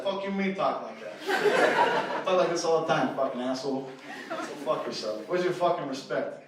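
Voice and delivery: very loud, indignant wannabe mobster; Italian tough guy. Acted. Wannabe gangster defends how he talks, loud indignant tough guy. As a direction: demand respect like a movie gangster. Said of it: "why you talking like that".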